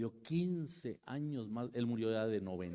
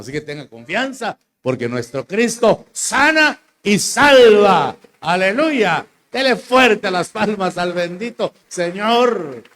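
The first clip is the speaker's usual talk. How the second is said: loud, high and slow, stretching each word; rally the church to clap and cheer; full-force preaching; 8 semitones higher, 16 dB louder, slower than usual. Acted. Preacher calling the church to applaud the Lord, loud and high.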